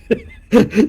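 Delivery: loud high laugh; burst of laughter. Real, not acted. Laughter at the mix-up.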